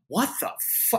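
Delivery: breathless high what the; stunned friends gasping at the sight; shocked friends. Retold or imitated. Friends at the party reacting to the hug, stunned and high. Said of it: "my friends are like".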